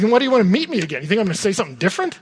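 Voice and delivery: high-pitched incredulous questioning, speeding up; mock exasperation, rushing the second question; mildly offended. Pastor to student who ignored his advice, exasperated disbelief. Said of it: "it's a little bit offensive to me".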